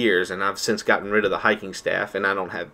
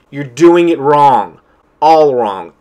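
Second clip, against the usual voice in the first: 1 emphatic rant; 4 semitones higher, 10 dB louder, much slower than usual. Real, not acted. Ranting at online commenters.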